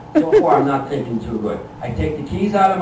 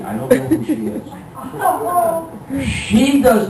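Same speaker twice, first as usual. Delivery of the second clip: astonished; 10 semitones higher, much slower than usual. Acted. Stranger found in car.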